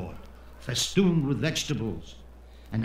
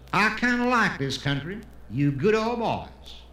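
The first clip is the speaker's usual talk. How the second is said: Texan; 3 semitones higher than usual. Acted. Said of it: "the Texan who comes to Israel".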